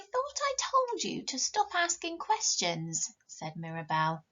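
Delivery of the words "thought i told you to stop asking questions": put-on high, slow, prim young princess scolding her friend; a haughty little princess telling her off; storybook princess, high and slow; bossy princess. Mirabell's line in read story.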